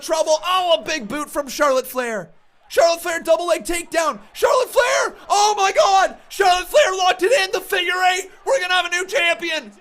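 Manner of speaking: loud, high, drawn-out victory call; excited shout. Real, not acted. Streamer calling the title change at the top of his voice. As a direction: scream the title change with full hype.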